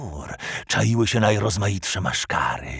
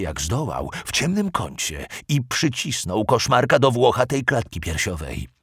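plain reading voice

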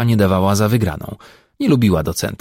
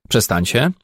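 ordinary delivery, nothing marked

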